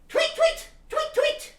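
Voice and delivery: very high chirpy tweeting; bird tweets. Retold or imitated. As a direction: chirp it like a little songbird. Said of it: "they sound like this".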